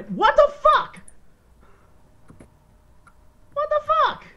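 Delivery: high shocked cry; shocked outburst. Real, not acted. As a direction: blurt it out in disbelief. Killed suddenly, shocked outburst.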